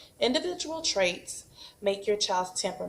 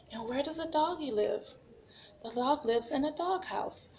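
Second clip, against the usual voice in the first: put-on 1 sing-song prompt to a child; 5 semitones higher, 3 dB quieter than usual. Shown as a demonstration. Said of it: "we also have a dog".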